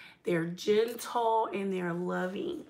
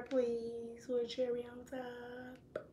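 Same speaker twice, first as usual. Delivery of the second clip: sweet ask; 12 dB quieter, slower than usual. Real, not acted. Sweetly asking partner for favor.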